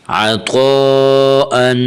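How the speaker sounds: very slow chant, long-held vowel in عطو; stretched madd. Shown as a demonstration. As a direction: stretch the طو of عطو to three alifs. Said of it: "الف کے برابر کھینچنا چاہیے". (عطو کو تین الف کے برابر کھینچ کر)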